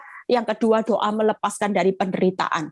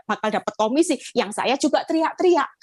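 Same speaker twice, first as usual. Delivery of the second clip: usual speaking voice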